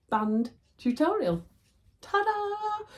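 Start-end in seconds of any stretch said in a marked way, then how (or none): 2.0-2.9 high, drawn-out, loud reveal; triumphant ta-da. Real, not acted. Showing viewers the finished band tutorial, triumphant ta-da. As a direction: sing it out high like a flourish.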